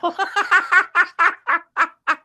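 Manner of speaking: a high burst of real laughter. Real, not acted. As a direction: let a high laugh break out at the story. Laughs at own retelling.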